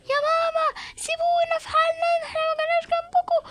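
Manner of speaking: a son begging the police to leave his mother; pleading child. Acted. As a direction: beg them to leave you alone. Son pleads as police take them.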